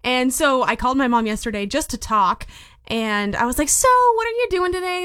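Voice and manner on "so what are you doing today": much higher, innocent phone voice; high bright fishing question. Retold or imitated. Calling mom, casually asking her plans, high and light.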